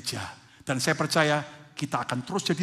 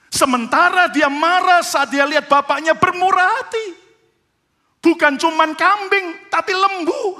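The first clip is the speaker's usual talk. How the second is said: loud, high, driving sermon delivery; full-force preaching; 13 semitones higher, 11 dB louder than usual. Acted. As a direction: preach it with rising fire to the congregation. Preaching the son's anger.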